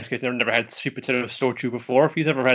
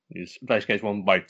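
ordinary talk, nothing marked

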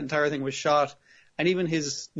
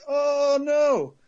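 mock dismay; 11 semitones higher, 5 dB louder than usual. Kay reacts to car exploding.

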